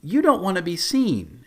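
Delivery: politely blunt. Acted. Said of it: "just doesn't beat around the bush".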